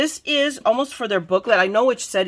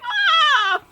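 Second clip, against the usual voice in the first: very high delighted shriek; excited squeal; 4 dB louder than usual. Retold or imitated. Crafter reliving her hashtag joy with a squeal. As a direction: let out a thrilled scream. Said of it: "That excited me so much".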